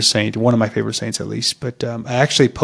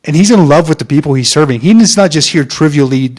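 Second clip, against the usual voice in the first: excited; 3 semitones higher, 7 dB louder than usual. Real, not acted. Enthusiastic about the Pope.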